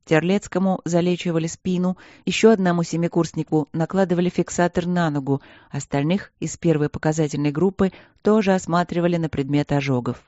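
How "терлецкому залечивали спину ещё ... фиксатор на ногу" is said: usual speaking voice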